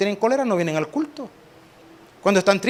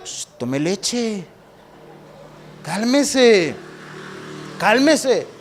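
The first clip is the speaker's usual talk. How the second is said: calmando a un bebé; much slower than usual. Pretend.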